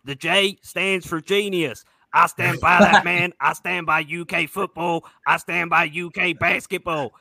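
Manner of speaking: hammed-up Kentucky good-ol'-boy accent, building to a yeehaw; American accent impression. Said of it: "needs to hear your American ac-, accent".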